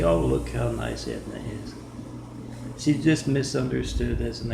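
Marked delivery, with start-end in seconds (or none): 0.0-1.7 much lower, syrupy mock-nice voice; mock sweet voice. Streets mocking Edna as nice, a low put-on sweet voice. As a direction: sell Edna as the nicest lady, put on. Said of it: "for the streets to say".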